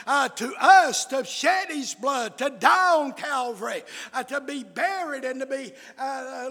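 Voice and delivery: driving revival sermon cadence; full-force preaching. Real, not acted. Preacher to congregation on the wages of sin, full-force preaching. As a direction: preach it hard, phrase by phrase.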